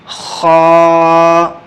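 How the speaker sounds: خا rasped from the throat; letter demo. Shown as a demonstration. Teacher sounding خا for students. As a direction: scrape خا from the back.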